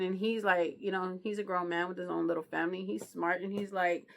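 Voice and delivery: ordinary talk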